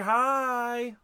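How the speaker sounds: overly sweet hi. Retold or imitated. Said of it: "and she's like".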